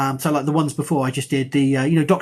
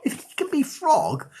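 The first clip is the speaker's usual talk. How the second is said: much higher and slower, leaning on be Frog; high, slow emphatic restatement; 10 semitones higher than usual. Real, not acted. Academic insisting to viewers that he too deserves a nickname, high and slow. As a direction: stretch it out up high to make the point land. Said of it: "what I'm trying to say is".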